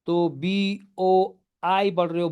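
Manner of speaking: ordinary talk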